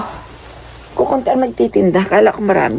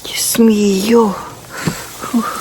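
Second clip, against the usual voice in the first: usual speaking voice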